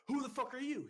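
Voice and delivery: high, loud repeated challenge; retold angry demand. Recruit leader pressing his brother recruit, loud angry demand. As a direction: re-enact pressing harder for the answer. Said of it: "And I just kept getting angry".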